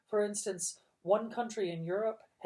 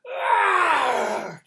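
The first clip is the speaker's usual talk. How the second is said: loud, higher roar of rage; villain roar; 12 semitones higher, 13 dB louder than usual. A narrator's reading. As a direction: roar out in fury. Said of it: "Count Olaf roared".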